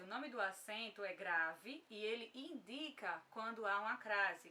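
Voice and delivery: usual speaking voice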